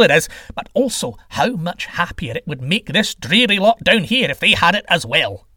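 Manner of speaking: impatient, emphatic sales pitch; exasperated sky god. Acted. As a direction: insult then persuade with fervour. Sky god insults and persuades.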